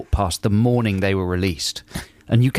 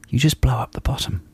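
whispered deadpan tip; a secretive whisper about the baby; mock whisper. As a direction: let the daft advice out in a whisper. Deadpan joke advice whispered.

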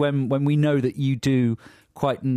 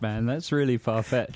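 normal talking voice

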